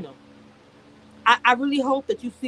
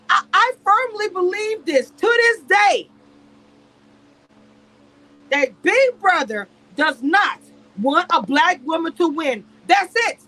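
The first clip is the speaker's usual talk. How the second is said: raised, insistent rant; indignant raised voice; heated rant; 5 semitones higher, 5 dB louder than usual. Real, not acted. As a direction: hammer the point home, louder. Ranting about Big Brother.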